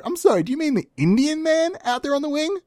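a mock-polite guest asking; partygoer. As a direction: play a puzzled, proper partygoer. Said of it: "They turn to me and go".

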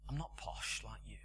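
put-on preacher quietly recalling the old lady's words; soft echo of her words. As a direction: replay "I'm not posh like you" quietly, thinking it over. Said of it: "thought about her a bit".

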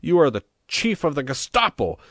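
loud, high-pitched accusation; vociferating. A narrator's reading. Mayor accusing the priest, vociferating. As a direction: hurl the accusation at full voice. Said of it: "he vociferated".

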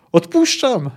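high, loud hurried cry; calling out. Acted. Poet gives in to the threatening executioner, calling out. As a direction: call it out to be heard. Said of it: "zawołał Jaskier".

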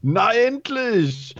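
high, slow relief; relieved high exclaim. A narrator's reading. Freed scientist as the door slides open, high relieved exclaim. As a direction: stretch it out, finally free. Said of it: "ruft er".

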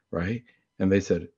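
ordinary delivery

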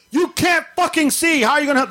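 high angry yell in dad's voice; dad going off. Retold or imitated. Dad yelling at mom for standing behind him, furious. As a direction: let the father blow up at her. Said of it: "my dad went off".

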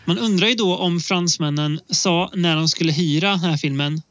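ordinary talk

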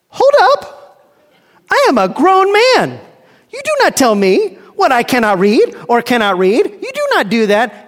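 the flesh bristling at being corrected; indignant flesh. Acted. Flesh indignantly talks back.

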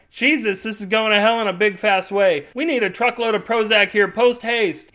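loud high urgent yell; screaming into radio. A narrator's reading. FBI agent screaming into the radio for Prozac. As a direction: bark the order in panic. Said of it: "he screams into the radio".

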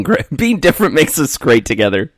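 laughing. Real, not acted. Laughing while reading option.

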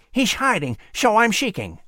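high squirrel voice with whistled esses; proud little squirrel, lisping through buck teeth; whistling through teeth. Acted.

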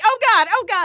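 high-pitched flustered imitation; mock panic. Driver imitating others scooting out of her way, panicked.

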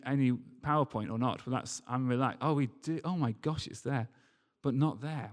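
ordinary talk, nothing marked

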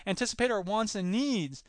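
ordinary talk

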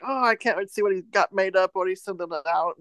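ordinary talk

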